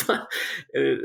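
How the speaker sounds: ordinary delivery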